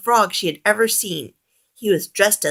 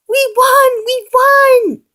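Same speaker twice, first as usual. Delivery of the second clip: very high, loud victory cry; ecstatic shout; 10 dB louder, much slower than usual. A narrator's reading. Gonzo shouts as they win the prize, high and loud. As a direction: whoop with joy. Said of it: "shouted Gonzo".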